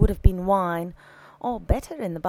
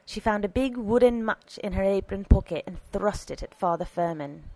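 plain reading voice

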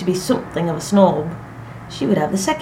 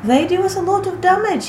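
high, raised, indignant; pitched-up, mildly angry protest; angered; 12 semitones higher, 4 dB louder than usual. A narrator's reading. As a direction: insist on it, stung.

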